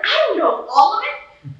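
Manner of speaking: high, loud retort; exasperated outburst. Retold or imitated. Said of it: "I have to be like".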